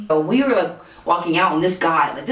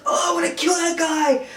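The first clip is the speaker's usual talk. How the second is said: high, strained mock tough guy; acting tough; 12 semitones higher than usual. Pepper-sprayed man trying to act all hard, mock tough. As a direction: imitate a hurt man putting on bravado. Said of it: "act all hard still".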